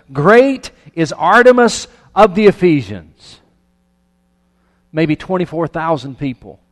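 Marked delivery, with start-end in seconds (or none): 0.1-3.4 very loud, high, drawn-out chant; crowd's shout. Acted. Thousands shouting for two hours in the theater, loud chant. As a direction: bellow it slowly, like a whole crowd. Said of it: "What were they shouting for two hours?".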